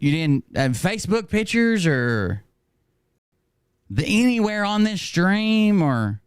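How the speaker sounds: high-pitched, drawn-out questioning; mock incredulity. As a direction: feign astonishment, high and slow, leaving questions hanging. Acts out mock questioning of critics.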